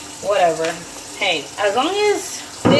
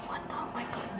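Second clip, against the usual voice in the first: hushed, voiceless aside; whispered dismay; 18 dB quieter, faster than usual. Retold or imitated. Mom reacting privately to son's question, whispered.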